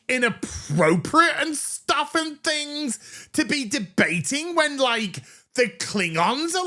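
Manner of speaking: put-on high, ditzy valley girl voice, opening with a shout; mock her as a shallow, whiny teen; mocking cadet girl. Reviewer mocks the cadet in debate class, high ditzy whine. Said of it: "And she's like".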